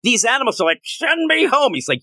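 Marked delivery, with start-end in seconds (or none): 0.8-1.7 pleading animal voice. Retold or imitated. Voicing caged zoo animals.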